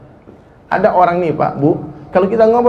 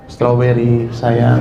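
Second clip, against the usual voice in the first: mock sweet pet name; 5 semitones lower, much slower than usual. Shown as a demonstration. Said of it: "panggilan kesayangan".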